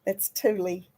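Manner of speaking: ordinary talk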